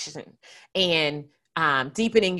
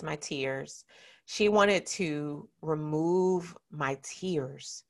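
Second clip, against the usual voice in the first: holding back tears; 6 dB quieter, much slower than usual. Real, not acted. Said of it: "I'm gonna try not to cry".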